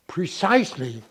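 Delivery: precisely punched high and loud; emphatic. Real, not acted. To the interviewer, precisely stressed loud and high. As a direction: hit precisely hard, rising up.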